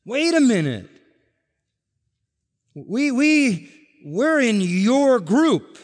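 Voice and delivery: high, loud, stammered objection; indignant protest. Acted. Rejected followers objecting at final judgment, indignant protest. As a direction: protest in disbelief, stretching the last words.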